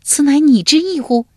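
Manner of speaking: high, loud, shocked question; greatly startled. A narrator's reading. Ai Jiang questions Shu Diao on being told to die, greatly startled. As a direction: demand it in sharp alarm. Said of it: "哀姜大惊道".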